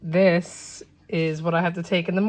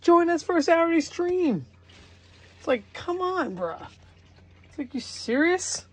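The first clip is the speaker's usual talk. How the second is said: high-pitched, incredulous complaint; worked-up, exasperated appeal; exasperated pleading; 7 semitones higher than usual. Real, not acted. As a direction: plead in disbelief, pitch jumping up. Pleading with absent cousin to join.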